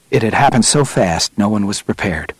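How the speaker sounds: usual speaking voice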